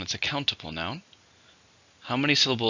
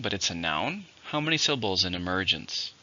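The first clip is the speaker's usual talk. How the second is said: normal talking voice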